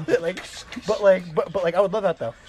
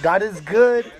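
frat bro; 5 semitones higher, 7 dB louder, much slower than usual. Acted. A chant about the hat slogan.

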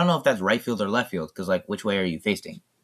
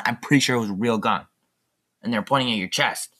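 ordinary delivery

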